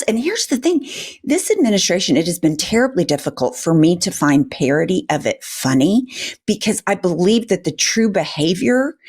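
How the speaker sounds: ordinary talk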